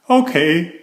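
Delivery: a short, decided okay; firmly. A narrator's reading. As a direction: settle it firmly. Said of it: "he said firmly".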